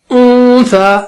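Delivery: اون سا with the n drawn out through the nose; nasal ghunna. Shown as a demonstration. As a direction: hum the n of اون سا for two beats, then release سا. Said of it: "اس طرح سے".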